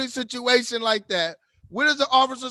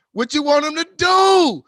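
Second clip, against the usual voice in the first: loud, higher, fired-up shout; exasperated shout; 6 semitones higher, 8 dB louder than usual. Real, not acted. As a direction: yell it back at the critic. Arguing back at a commenter.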